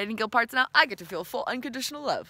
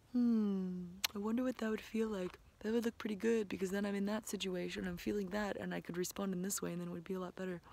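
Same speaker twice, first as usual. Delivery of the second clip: quiet pondering inner voice; 7 dB quieter than usual. Pretend.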